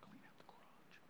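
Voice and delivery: breathy hushed command; whispered order. Retold or imitated. Dad repeats the order to the kid, whispered. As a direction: hiss the order under your breath.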